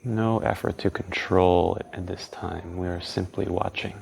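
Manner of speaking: calm, unhurried guiding tone; guided meditation. Acted.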